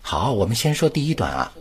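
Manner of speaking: ordinary delivery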